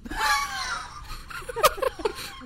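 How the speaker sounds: squealing high laugh; high giggling burst; high-pitched laugh. Real, not acted.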